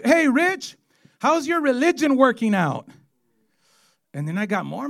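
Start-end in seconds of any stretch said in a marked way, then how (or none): 0.0-2.9 teasing coworker. Retold or imitated. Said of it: "he goes".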